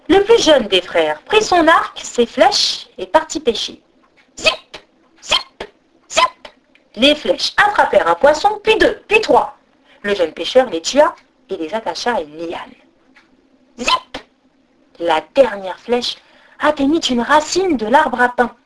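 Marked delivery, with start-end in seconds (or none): 4.4-6.5 high-pitched quick arrow zips; short, high arrow sound effects. A narrator's reading. Narrator imitates three arrows. 13.8-14.2 high-pitched quick arrow zip; short, high sound effect of an arrow. A narrator's reading. Narrator imitates flying arrow.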